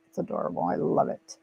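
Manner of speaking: breathy whisper of fond approval; whispered aside. Real, not acted. Whispering to viewers about Sandra's cute accent. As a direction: whisper it like a little secret to viewers.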